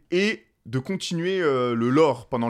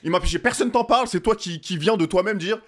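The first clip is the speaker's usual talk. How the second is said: escalating comedy rant; 4 semitones higher than usual. Acted. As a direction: rant faster and higher as it builds. Escalating rant voicing the liar.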